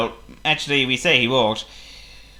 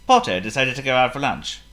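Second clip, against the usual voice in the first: plain reading voice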